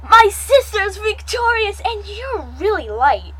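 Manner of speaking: high, excited crow of victory. A narrator's reading. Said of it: "Jovin crowded".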